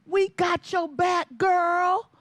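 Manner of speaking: family shouting support at the swim meet; cheering family. Retold or imitated. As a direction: hype her up from the stands. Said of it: "They're like".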